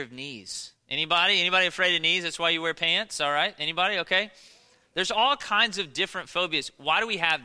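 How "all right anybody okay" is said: ordinary talk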